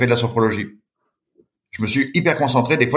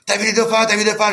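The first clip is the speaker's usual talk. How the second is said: much higher, excited shout; excited yelling; 10 semitones higher than usual. Retold or imitated. Team shouts to the swimmer about the dolphins, much higher excited call. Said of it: "toute mon équipe me gueule dessus".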